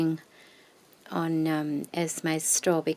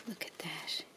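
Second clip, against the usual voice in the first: whisper=40; 16 dB quieter than usual. Real, not acted. Whispering while unfolding.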